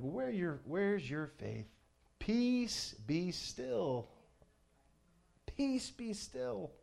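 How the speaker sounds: a lightly voiced, soothing command to the sea; Jesus calming the storm.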